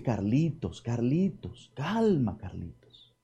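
softly soothing a worried man; hushed, reassuring "Carlitos, calma"; soothing calm. Acted. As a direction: settle him down with a quiet "calma". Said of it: "y le dice".

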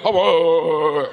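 hammed-up grunts of a man in bed; mock grunting. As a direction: grunt it out like a clumsy husband. Husbands mocked grunting in bed.

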